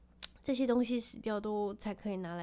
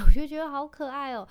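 laughing through the words, higher and louder; giggly, charmed aside; laughing fondly; 6 semitones higher, 16 dB louder than usual. Real, not acted. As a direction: break into a delighted laugh as you say it. Laughing over the cute cards.

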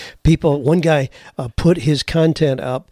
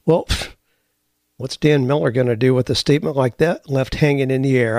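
normal talking voice

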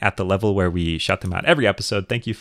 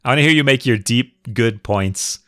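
ordinary talk

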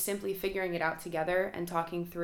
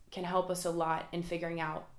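ordinary delivery

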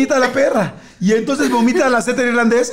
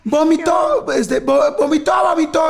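high shouted alarm; 8 semitones higher, slower than usual. Retold or imitated. Kids shout to the parents up front: high shouted alarm. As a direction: yell it to the front seat.

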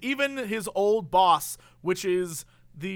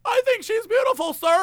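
put-on high, loud, over-polite young man; flustered eager answer; 14 semitones higher, 6 dB louder than usual. One of the guys answers the father, high flustered mock earnestness. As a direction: blurt it high and loud, hammed-up nerves.

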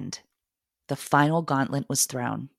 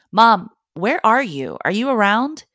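calling out; 7 semitones higher, 7 dB louder than usual. Retold or imitated. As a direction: call out as if searching the house for mom. Child calling out for mom.

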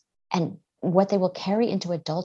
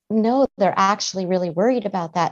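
usual speaking voice

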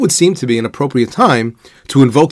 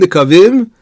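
nikavim lifted and louder with a rise; stressed repeat; 5 semitones higher, 5 dB louder than usual. Shown as a demonstration. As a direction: push the repeated nikavim up to flag it. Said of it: "why do we say we have nikavim".

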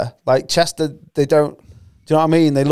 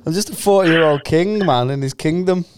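normal talking voice

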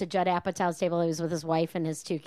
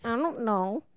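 put-on pouty baby refusals; 3 semitones higher, 4 dB quieter than usual. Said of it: "That's my favorite".

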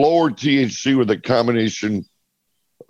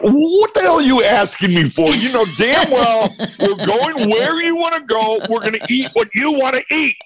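exasperated outburst; 8 semitones higher than usual. Retold or imitated. Exasperated retort to wife.

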